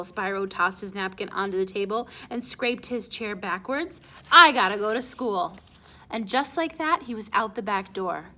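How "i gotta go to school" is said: loud, curt announcement; curt exit. Acted. Spyro leaving the table in a huff, loud and curt. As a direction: bark it on the way out.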